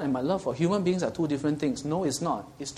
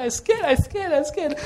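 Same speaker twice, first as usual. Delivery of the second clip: put-on much higher, louder frightened child's cry; scared child; 6 dB louder than usual. Child frightened by cockroaches, calling for Papa, high scared cry. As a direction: squeal it like a terrified little kid. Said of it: "like a little child".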